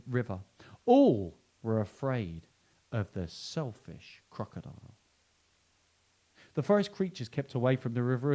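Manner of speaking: put-on lively read-aloud for children; animated picture-book reading; storybook narration. A narrator's reading. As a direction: tell it like a bedtime story, with emphasis.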